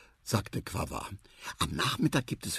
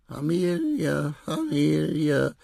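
slow, high, wistful sigh of a word; sad little dog; 7 semitones higher, slower than usual. Acted. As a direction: let each Familie sink sadly and slowly. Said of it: "sagte das Rüsselhündchen traurig". (traurig und langsam gesprochen)

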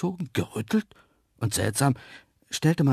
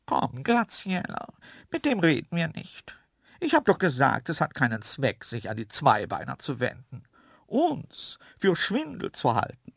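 offended creature voice, pitched up at start and end; huffy antelope; 4 semitones higher than usual. A narrator's reading. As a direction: refuse in a huff as a dainty antelope. (eingeschnappte Antilopenstimme)

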